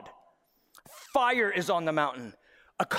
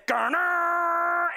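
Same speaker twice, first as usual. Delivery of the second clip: high shouted trumpet call; a voice playing the trumpet; trumpet blast; 10 semitones higher, 4 dB louder than usual. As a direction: blare it out like a horn.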